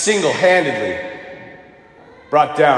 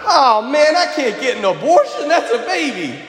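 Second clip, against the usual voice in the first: high, hammed-up dawning horror; acted dismayed realization; 5 semitones higher than usual. Lineman realizing his mistake, high exaggerated dismay. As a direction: imitate someone suddenly getting it, pitched up.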